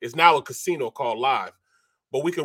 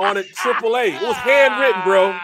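louder, high, punch handwritten; loud outrage; 4 semitones higher, 7 dB louder than usual. Real, not acted. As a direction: hammer home the absurd punchline.

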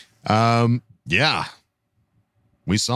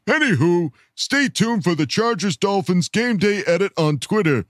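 higher, put-on peppy voice; chatty fan character; 8 semitones higher than usual. Reading a fan's note about a game day edit, put-on chirpy voice. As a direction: rattle it off like a perky social media greeting.

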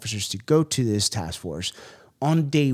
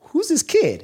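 agents sizing up rookie; 7 dB louder than usual. Retold or imitated. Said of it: "everybody was like".

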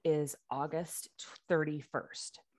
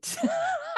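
high laugh. Real, not acted. Reacting to mom's reply.

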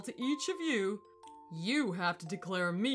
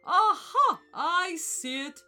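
high, loud triumphant "Aha"; booming elephant discovery; 7 semitones higher, 8 dB louder, slower than usual. A narrator's reading. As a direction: crow it out, then slow down. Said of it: "he said loudly".

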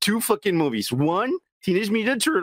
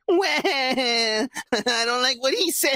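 put-on higher, whiny kid voice through a laugh; mock crybaby whine; 6 semitones higher, slower than usual. Laughing friend mocks whiny kids, high crybaby whine. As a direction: whine like an offended child.

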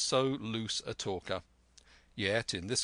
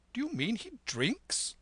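high-pitched astonished squire's question; crying out. A narrator's reading. Squire questions captain about the mate, crying out. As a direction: exclaim it, pitch leaping right up. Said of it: "cried the squire".

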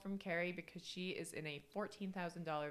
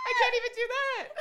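loud, high-pitched outburst; laughing exasperation; 13 dB louder than usual. Real, not acted. Co-host giving up on the accent, loud exasperated shout. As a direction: cry out in comic defeat.

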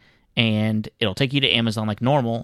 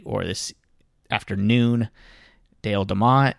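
ordinary talk

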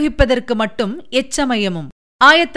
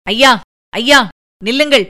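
urgent raised cry, louder, repeating ஐயா; frantic shout; 4 dB louder than usual. A narrator's reading. Kandhan Maran begging the prince to stop, raised shout. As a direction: call out to stop the prince before the boar. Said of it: "Kandamaran கூச்சலிட்டான்".

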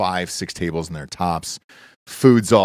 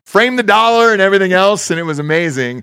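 excited triumph; 11 semitones higher, 5 dB louder than usual. Retold or imitated. Retold triumph as the film happens.

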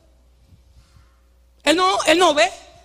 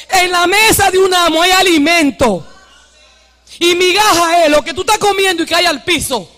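preaching; 5 semitones higher, 6 dB louder than usual. Acted. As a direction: drive each exhortation home to the congregation. Preaches at full force.

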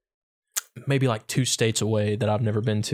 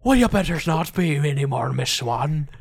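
hammed-up pirate movie line, higher at the start; movie line delivery; 4 semitones higher, 3 dB louder than usual. Quoting a pirate film to a co-host, theatrical line delivery. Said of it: "That line delivery is".

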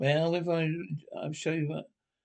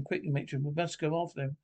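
usual speaking voice

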